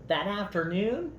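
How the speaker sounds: lively, expressive storybook read-aloud; reading to children. A narrator's reading. Parent reading a picture book to children, lively storybook voice.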